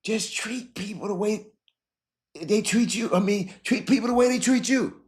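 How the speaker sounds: high, strained and tearful; begging them to be fair, voice breaking; emotional plea. Real, not acted. Host urging listeners to treat others fairly, tearful and high.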